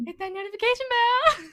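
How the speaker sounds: high, loud YouTuber-style plug; playful shouted promo; mock hype. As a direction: call it out like a hyped-up channel plug. Joins the mock subscribe pitch.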